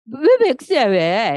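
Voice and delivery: high, loud why; retorting mother. Retold or imitated. Mother to daughter, loud shocked retort. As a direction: push back hard, half shocked. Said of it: "이랬더니".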